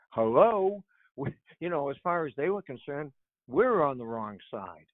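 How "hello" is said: louder, higher, pointed hello; mock-incredulous, raised voice. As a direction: call out as if nobody gets the obvious. Sarcastic aside on poppy policy.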